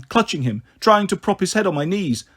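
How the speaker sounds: plain reading voice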